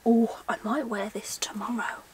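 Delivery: ordinary talk, nothing marked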